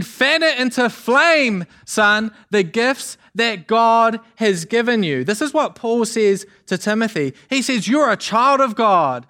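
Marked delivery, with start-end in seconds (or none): 0.0-5.2 raised, slow, emphatic preacher's rallying cry; pitched up and drawn out to rouse him; fired-up encouragement. Acted. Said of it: "those voices of truth encouraging us". 7.9-9.3 put-on high, impassioned preaching to a young protégé; raised, emphatic pep talk from a mentor; fired-up encouragement. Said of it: "Did that get you fired up?".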